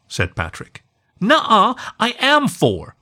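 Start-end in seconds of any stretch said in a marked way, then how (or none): 1.2-2.9 put-on high, insistent little girl, a bit louder; a small pup arguing back, pitched up; countering little girl. A narrator's reading. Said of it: "Jessica countered".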